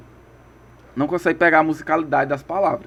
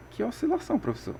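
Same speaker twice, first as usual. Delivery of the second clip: imagined student; 7 semitones higher, 8 dB quieter, faster than usual. Pretend. Teacher voicing a confused pupil's question. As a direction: play the puzzled student asking the teacher.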